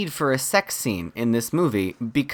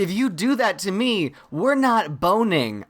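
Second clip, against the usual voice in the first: mock indignation; 7 semitones higher than usual. Voicing her own refusal.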